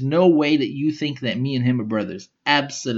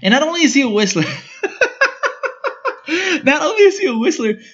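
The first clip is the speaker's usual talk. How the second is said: laughing rant; 12 semitones higher, 5 dB louder than usual. Real, not acted. Laughing rant about whistling.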